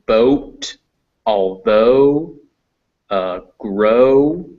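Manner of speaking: "boat, although, grow" with the O held; example words for O. Shown as a demonstration. Teacher giving examples of O, held vowels in "boat, although, grow". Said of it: "this is O, like the letter".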